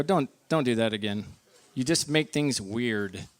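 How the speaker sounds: low, quiet, slowing put-down; deadpan rebuke. Retold or imitated. Husband looking at wife after her joke, quiet deadpan rebuke. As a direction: shut it down softly and low.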